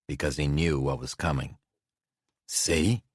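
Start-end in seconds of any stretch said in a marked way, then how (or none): 2.5-3.0 exclaiming. A narrator's reading. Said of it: "Nate exclaimed".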